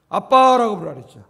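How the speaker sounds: loud, stressed declaration; loud sermon emphasis; emphatic preaching. Acted. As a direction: drive the point home.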